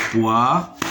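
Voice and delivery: "Poire" split as poi-re, first part rising; syllable by syllable. Shown as a demonstration. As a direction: detach the final "re" of "Poire" after a pause. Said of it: "se compose de deux syllabes". (« Poire » découpé : poi… re)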